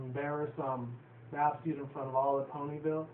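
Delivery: ordinary talk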